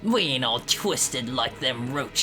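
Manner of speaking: put-on British game character; British accent. Challenging an intruder with a poker, mock British accent. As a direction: play it as a rough-spoken British bug. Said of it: "This is my British voice".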